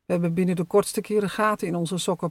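ordinary delivery, nothing marked

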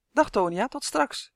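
light parting call, lifting high at the end; called back. A narrator's reading. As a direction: call a goodbye back over your shoulder. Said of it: "riep hij achterom". (luchtig achterom geroepen)

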